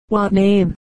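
plain reading voice

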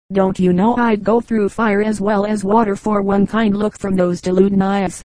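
ordinary delivery, nothing marked

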